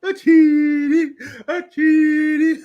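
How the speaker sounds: high hammed-up sobbing cry of "I cheated"; Tin Man crying. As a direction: wail it like the weeping Tin Man. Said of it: "crying like the Tin Man on The Wiz".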